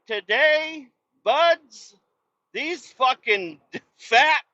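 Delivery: laughing-edged road rant; exasperated rant. Real, not acted. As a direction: pile on the insults with disbelief. Ranting at Tulsa drivers.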